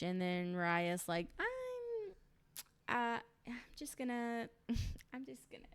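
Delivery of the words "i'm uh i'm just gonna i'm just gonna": soft, high, hesitant trailing off with giggles; mock awkward exit. Raya excusing herself from Lisa's confrontation, awkwardly hesitant. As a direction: imitate edging out of an awkward moment.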